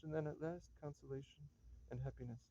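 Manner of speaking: usual speaking voice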